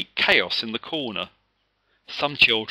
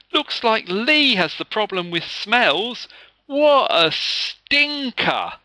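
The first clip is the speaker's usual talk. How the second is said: high, loud, slow teasing; boy teasing the bully about smells; mocking boy; 12 semitones higher, 7 dB louder, slower than usual. A narrator's reading. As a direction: draw out the jeer. Anthony mocks Lee.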